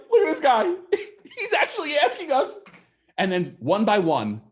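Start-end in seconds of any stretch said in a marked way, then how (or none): 0.1-2.5 higher, laughing as you scoff at him; a sneering buyer cracking up mid-line; mocking laughter. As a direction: laugh through the words in disbelief. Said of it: "they start laughing hysterically".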